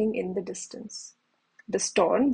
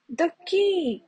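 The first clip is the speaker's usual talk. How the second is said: a much higher cry of alarm; cried out; 7 semitones higher than usual. A narrator's reading. Kipper seeing the key struck by lightning, crying out. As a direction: blurt it out as the sparks fly. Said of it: "cried Kipper".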